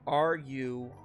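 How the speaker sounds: usual speaking voice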